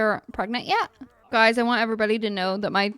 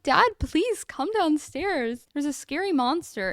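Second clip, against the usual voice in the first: put-on high, slow, pleading child voice; small child pleading with dad; scared little girl; 5 semitones higher than usual. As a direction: beg like a frightened kid. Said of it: "She's like".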